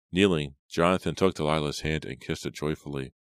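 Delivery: ordinary delivery, nothing marked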